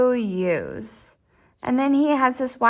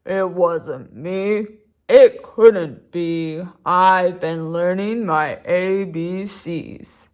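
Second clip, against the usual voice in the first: a lower, slow alligator voice; 5 semitones lower, slower than usual. Acted. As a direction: drag it out low like a big alligator. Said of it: "Alligator said".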